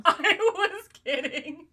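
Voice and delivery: high, loud, drawn-out plea through laughter; laughing protest. Real, not acted. Streamer pleads she was kidding, laughing protest. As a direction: wail it slowly while cracking up.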